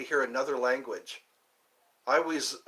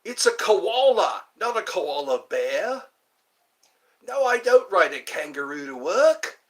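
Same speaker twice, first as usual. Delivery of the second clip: put-on Australian accent; 4 semitones higher, 5 dB louder than usual. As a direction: set them straight in a put-on Aussie accent. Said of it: "The Australian accent".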